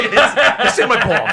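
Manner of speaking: cracking up while repeating it; words squeezed out through laughter; laughing. Real, not acted. Repeats line laughing hard.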